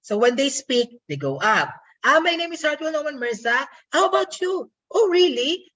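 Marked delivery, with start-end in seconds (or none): 2.0-5.7 "Ah, my name is Hartwell Norman Mirza. How about you? Oh, really?" pitched high and lilting; high sing-song lilt from "Ah, my name" through "really"; sing-song intonation. Shown as a demonstration. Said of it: "characterized as sing-song".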